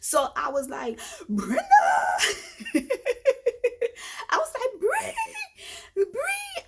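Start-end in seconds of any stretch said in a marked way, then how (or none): 1.3-6.6 high wailing call for a friend, laughing between; calling out a name. Retold or imitated. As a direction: cry out her name in mock desperation. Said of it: "Just screaming out her name".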